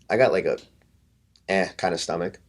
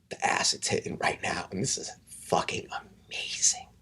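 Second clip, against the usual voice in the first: hushed, breathless whisper of a tripping friend; soft awed whisper, barely voiced; 8 dB quieter than usual. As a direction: whisper it like a secret you can't contain. Tripping friend whispers his excitement.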